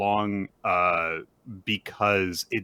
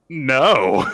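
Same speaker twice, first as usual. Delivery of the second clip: retold loud refusal; 5 semitones higher, 9 dB louder than usual. Boy refuses dolly ride, retold.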